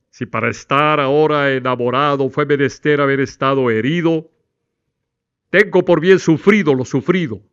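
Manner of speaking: loud, full projected poem recital. Shown as a demonstration. Teacher shows projected voice to the class. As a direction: project from the breath, out the mouth. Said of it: "Fíjense la diferencia".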